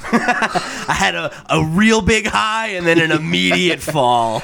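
laughing through the words; slow words broken by laughter. Real, not acted. Laughing while telling story.

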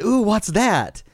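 put-on high, slow curious kid voice; a lightly voiced child. A father imitating his son asking about a picture, high and slow.

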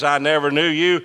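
emphatic verdict in a sermon. Acted. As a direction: punch the quote out. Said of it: "Jesus emphasized that when he says".

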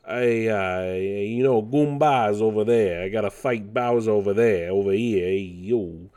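New York wise-guy Mario; New York accent. Retold or imitated. Hammed-up Mario impression for viewers, New York accent. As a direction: drop the r's like a New Yorker. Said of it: "he's talking like that almost".

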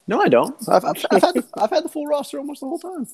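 high-pitched, squeaky denial; wounded, squeaky insistence; indignant protest. Real, not acted. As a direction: defend yourself, voice shooting way up. Indignant denial of a friend's teasing.

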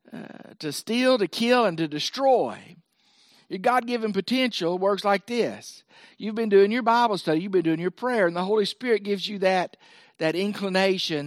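ordinary delivery

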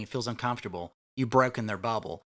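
ordinary talk, nothing marked

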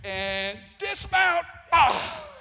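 drawn-out count exploding into a high yell; high, very loud jumping cry; dismount shout. Real, not acted. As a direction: count slowly, then shout as you leap. Jumping off a swing onstage.

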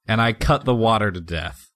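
ordinary delivery

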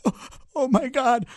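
high, slow tearful mom voice; a mom barely holding back tears; mildly imitated crying mom. Teller imitating his shaken mom in the story, tearful and high. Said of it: "She's like".

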